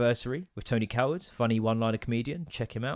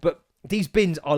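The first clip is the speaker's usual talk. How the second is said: ordinary delivery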